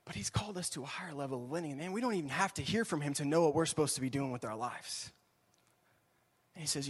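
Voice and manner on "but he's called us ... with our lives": ordinary talk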